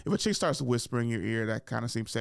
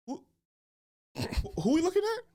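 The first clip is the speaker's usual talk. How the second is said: mock confused. Pretending he doesn't know her.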